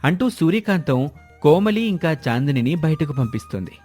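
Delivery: ordinary delivery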